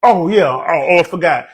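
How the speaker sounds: ordinary delivery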